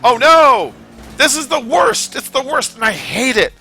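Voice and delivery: high, loud groan of dismay; dismayed cry. Real, not acted. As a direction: cry out at the bad turn. Reacting to game trouble.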